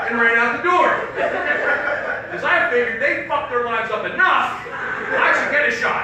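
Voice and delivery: loud, high comic yell; shouted punchline. Real, not acted. Comic shouting the punchline to the audience. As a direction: belt it to the back row.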